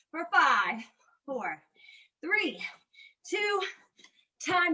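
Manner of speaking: energetic trainer cueing mountain climbers; fitness coach cues. Acted. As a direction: push the class through the interval.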